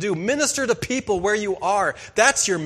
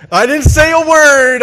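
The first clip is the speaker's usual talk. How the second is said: much louder and higher, laughing protest of innocence; 8 semitones higher, 13 dB louder than usual. Retold or imitated. Grandson protesting to grandpa, a loud high laughing protest. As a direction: insist you said nothing, loud and high.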